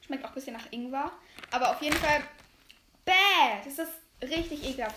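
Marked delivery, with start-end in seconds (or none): none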